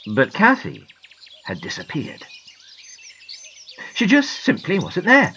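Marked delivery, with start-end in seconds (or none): none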